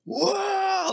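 high mock crowd roar. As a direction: cheer like a crowd from afar. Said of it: "this big roar".

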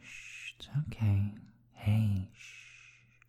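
hushed, comforting shushing; calm them gently with a whisper; soothing whisper. Acted. Comforting a partner.

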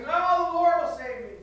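loud, high, certain; stubborn refusal. Acted. Man on porch refuses the truck driver, loud and sure. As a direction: refuse with total faith.

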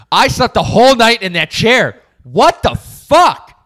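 re-enacted loud, high-pitched disbelief; shocked outburst. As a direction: blurt it out loud, aghast at the retold discovery. Retelling his shock at waking in chair.

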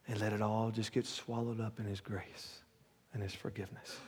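low, hushed altar-call preaching; quiet preaching. Acted. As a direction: draw them in softly and low. Softens to intimate altar call.

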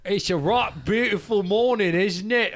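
attempted British morning greeting; British accent. Trying to win back a lost accent, British accent. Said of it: "Oh, that's British".

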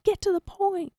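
high, thin heckle; impatient audience. Retold or imitated. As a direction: mimic an impatient crowd in a high voice. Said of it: "Y'all are like".

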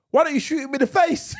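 high, loud, laughing heckle; mock shouting. Friend acting out someone in the film, high mock shout. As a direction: call it out like an excited onlooker.

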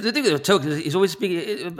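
lightly voiced Tolkien, as if talking round a pipe; a hint of Tolkien mumbling past a pipe; mild Tolkien voice for a friend who asked; Tolkien impression. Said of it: "can we hear a bit of that".

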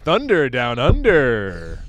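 loud, drawn-out stretched words; hammed-up promo call; mock announcer slogan. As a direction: draw it out big and slow like a show promo.

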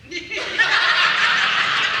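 breathless high-pitched giggle; high laugh. Real, not acted. Laughing at his own story.

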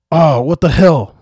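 high, loud startled scream; drawn-out outraged yell. A narrator's reading. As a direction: scream it out as if dirt just hit your eyes. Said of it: "screamed the guard".

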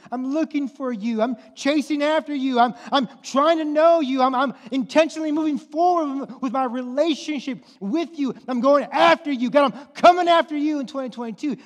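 loud, high, fired-up preaching; full-force preaching. Acted. Preacher fired up, driving home that Jesus disrupts life. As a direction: preach it out at the congregation.

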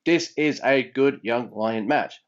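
slow, careful delivery. Shown as a demonstration. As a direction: spell out each word slowly. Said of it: "I have to say that slower".

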